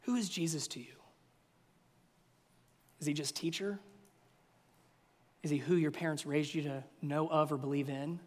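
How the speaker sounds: low, quiet, searching questions; soft appeal. Real, not acted. Preacher quietly asking the congregation to reflect. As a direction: speak softly, leave space after each.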